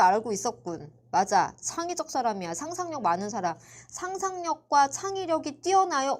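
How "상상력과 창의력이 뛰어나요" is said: 높고 느리게 늘여 장난스레 묻기; 과장되게 높인 목소리로 떠보듯; 비꼬는 척 질문. Pretend. 싫어하는 학생 유형에게 가상으로 묻기. (짐짓 비꼬듯 천천히)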